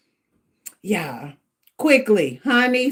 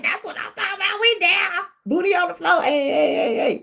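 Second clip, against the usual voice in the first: higher, bouncy boasting chant; hyped bragging; 9 semitones higher than usual. Retold or imitated. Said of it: "And I was like".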